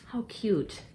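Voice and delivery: ordinary talk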